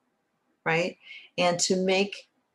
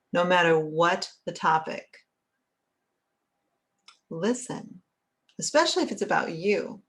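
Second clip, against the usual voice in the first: normal talking voice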